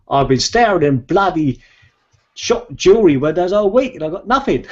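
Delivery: high, loud, hammed-up Australian accent; mock Australian accent. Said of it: "excuse my Australian accent".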